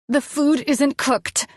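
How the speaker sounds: high, slow, seething insistence; rising rage. Acted. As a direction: press each word with growing anger. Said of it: "my rage growing".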